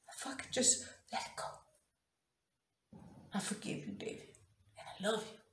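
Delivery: barely voiced, breath-like whisper; hushed surrender. Woman reliving forgiving the man who cheated, hushed surrender. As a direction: let it go in a near whisper.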